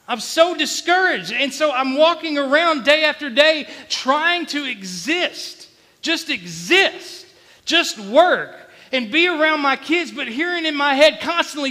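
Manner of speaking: loud, high, drawn-out testimony; full-force, anguished pulpit delivery; impassioned preaching. Real, not acted. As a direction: cry out the struggle to the congregation, stretching key words.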